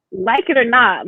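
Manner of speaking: usual speaking voice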